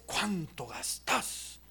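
soft, very slow husband's reproach; nagging husband. Acted. Husband who only notices his wife's spending, nagging. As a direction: stretch it out as a quiet, critical dig. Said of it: "Lo único que puede notar es".

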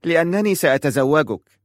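put-on high, loud child's firm declaration; decisive little girl. A narrator's reading. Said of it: "فقالت في حسم".

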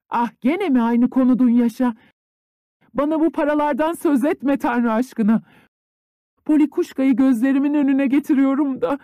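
high, loud stern mistress. A narrator's reading. Mistress refusing the money, voice high and stern. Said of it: "sert bir sesle".

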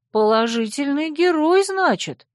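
lightly mocking, rising high; high ironic question. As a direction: voice climbs high, skeptical. Heroine asks ironically. (высоко, с иронией)

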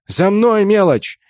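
high, commanding call; rallying shout. A narrator's reading. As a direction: call the small fry to follow. Leader rallying the squad.

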